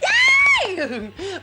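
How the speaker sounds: loud laughing squeal of yay. Real, not acted. Host gushing about painting skulls on her bedroom wall, laughing cheer.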